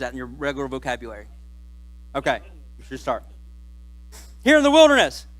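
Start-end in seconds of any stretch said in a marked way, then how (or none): 4.4-5.3 urgent Reuben. Acted. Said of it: "Reuben said".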